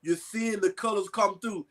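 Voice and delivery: ordinary talk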